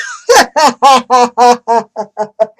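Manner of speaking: real burst of loud laughter; a loud, genuine laugh of disbelief. Real, not acted. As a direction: laugh out loud at the absurd text.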